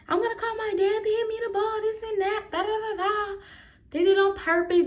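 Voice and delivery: mocking tattletale. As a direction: squeak it out like a snitching kid. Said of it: "was like".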